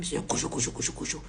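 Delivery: rhythmic hushed shuffling sound effect; imitated scurrying noise. Storyteller imitates robbers leaving in no time, scurrying noise. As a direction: mimic a quick scurry with repeated syllables.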